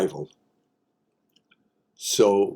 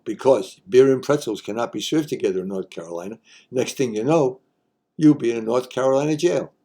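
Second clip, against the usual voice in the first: normal talking voice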